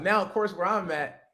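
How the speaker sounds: ordinary talk, nothing marked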